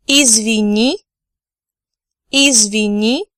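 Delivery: "Извини" said clearly for repetition; teacher's careful "Извини"; clear drill. Shown as a demonstration. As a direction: model it for learners. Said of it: "I'm going to repeat each word twice".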